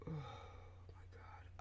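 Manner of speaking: breathy whisper after a sigh, slow at first. Real, not acted. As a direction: let it out under your breath, barely voiced. Suddenly angry, talks under breath.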